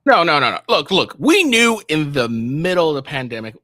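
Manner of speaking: loud interruption. Real, not acted. Guest cuts off the panel, loud and high. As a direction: barge in to stop them. Cutting in on debate.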